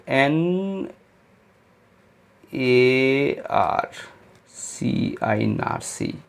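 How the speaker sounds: drawn out letter by letter; teacher spelling slowly for memory. Shown as a demonstration. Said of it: "बस याद रख लीजिए".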